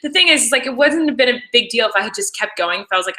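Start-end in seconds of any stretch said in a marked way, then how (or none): none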